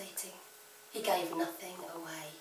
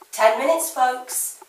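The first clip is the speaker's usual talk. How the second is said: loud, raised shout to the class; calling out; 5 semitones higher, 17 dB louder than usual. Acted. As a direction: shout the time call across the room. Art teacher calls time.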